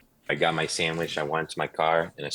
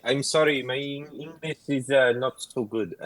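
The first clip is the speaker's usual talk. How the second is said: high, put-on broken-English accent; Borat accent; 6 semitones higher than usual. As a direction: pretend your English is bad, Borat style. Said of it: "I went full on Borat".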